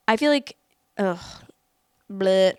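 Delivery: loud disgusted ugh then bleh. Real, not acted. Disgusted reaction to the dad.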